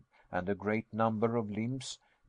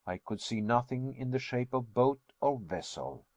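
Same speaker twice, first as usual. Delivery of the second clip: ordinary delivery, nothing marked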